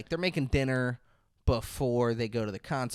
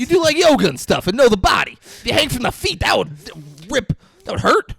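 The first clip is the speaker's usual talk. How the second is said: louder, higher, exasperated shouting; loud insistence; 8 semitones higher, 8 dB louder than usual. Real, not acted. Co-host insisting in a friendly argument, loud. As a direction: argue the point at full volume. Heated argument about hanging.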